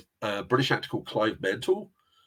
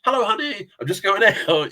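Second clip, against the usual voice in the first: high, cheery off-camera voice calling out; imitating an actor calling from another room, higher and louder; 7 semitones higher, 4 dB louder than usual. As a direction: sing out a casual goodbye from offscreen, pitched well up. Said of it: "you off camera going".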